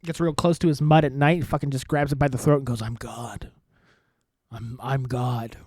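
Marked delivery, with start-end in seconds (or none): 2.8-5.6 low, soft and drawn-out murmur; hushed menace. Retold or imitated. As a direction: quietly claim godhood, low and slow. Acting out him grabbing throat.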